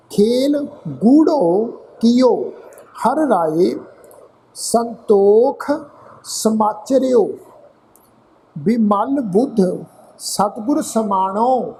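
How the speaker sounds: slow, drawn-out chanted reading of the verse; slow scripture recitation. Acted. Teacher reciting scripture to the congregation, slow and chanted. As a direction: intone each line slowly like a hymn.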